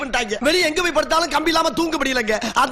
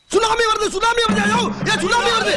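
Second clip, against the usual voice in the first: fake panic alarm; 8 semitones higher than usual. Prankster yells to the queue in fake panic. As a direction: raise a fake panic to scatter the crowd.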